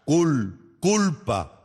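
"culpa" broken after "Cul-", then whole; corrective pronunciation. Shown as a demonstration. Judge correcting the defendant, "culpa" with hard C.

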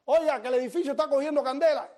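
loud, high shout up to a rooftop; urgent yell to someone far above; calling out. Retold or imitated. Passerby warning a man on the rooftop of fire, shouting. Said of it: "Entonces le empiezo a gritar".

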